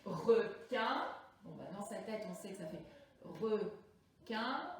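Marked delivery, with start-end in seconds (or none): 0.0-1.1 "requin" split in two, a clear pause between syllables; découpage syllabique. Shown as a demonstration. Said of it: "autant de carrés que vous entendez de syllabes". 3.2-4.8 "requin" in two separate, measured syllables; découpage syllabique. Shown as a demonstration. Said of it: "deux syllabes".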